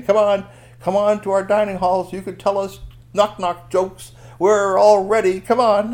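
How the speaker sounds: high, loud eager welcome; eager guards. Acted. Palace guards eagerly inviting Strongbones to their dining hall. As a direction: beckon him in eagerly.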